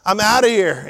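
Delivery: frantic, flustered escape; panicked exit. Retold or imitated. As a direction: blurt it out as you bolt for the door. Boy fleeing temptation, voiced.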